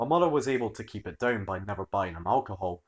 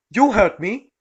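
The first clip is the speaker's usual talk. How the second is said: angry snap; 11 semitones higher, 10 dB louder, slower than usual. Acted. Entitled mum snapping.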